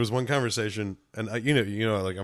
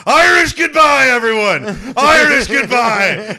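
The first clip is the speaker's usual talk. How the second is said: much higher, very loud, slow shouted announcement; drunken shout; 13 dB louder, slower than usual. Retold or imitated. Standing on something at the bar, shouting his Irish goodbye to everyone. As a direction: bellow it to the whole bar. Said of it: "get everyone's attention and just go".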